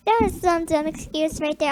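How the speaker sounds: ordinary talk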